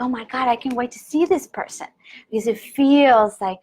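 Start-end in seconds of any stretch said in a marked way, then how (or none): 0.0-1.9 excited. Retold or imitated. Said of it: "we're like".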